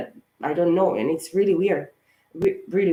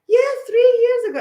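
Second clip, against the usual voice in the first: much higher, louder mock-chirpy phone lady; 7 dB louder than usual. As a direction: parody her blithe confirmation. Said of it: "the lady on the phone, he was like".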